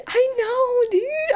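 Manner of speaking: high excited squeal, near crying. Real, not acted. Streamer squeals over friends who met, high squeal.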